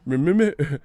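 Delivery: high, loud stammer; stuttering. Retold or imitated. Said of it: "He said".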